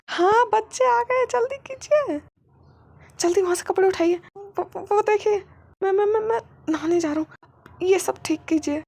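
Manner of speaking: high, loud, flustered stammering orders; panicked orders. Acted. Wing frantically telling his husband to tidy up, loud and high. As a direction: rush him, voice up in panic.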